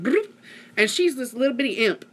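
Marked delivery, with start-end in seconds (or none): 0.0-0.3 imitated bump noise.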